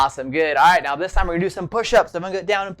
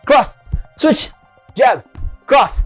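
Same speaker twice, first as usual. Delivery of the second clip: loud, higher calls; shouted drill commands; 7 semitones higher, 5 dB louder, much slower than usual. Shown as a demonstration. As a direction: bark out each strike. Calling out punch combo.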